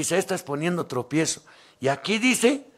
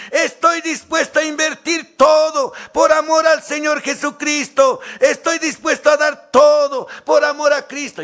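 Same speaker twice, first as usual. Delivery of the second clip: higher, louder shout of devotion; fervent shout; 10 semitones higher, 6 dB louder than usual. Acted. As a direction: proclaim it like a zealous believer.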